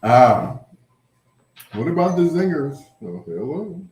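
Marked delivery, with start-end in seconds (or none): none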